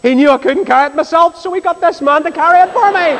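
high, climbing jubilant delivery; triumphant punchline. Retold or imitated. Billy praises God for the free carrier, triumphant punchline. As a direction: build gleefully to a high finish.

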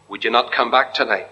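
ordinary talk, nothing marked

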